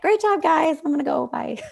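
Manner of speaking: high, quick cheerful send-off; a breezy writer waving goodbye on the way out; breezy exit. Retold or imitated. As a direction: writer in the back cheerfully leaving. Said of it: "the writer in the back being like".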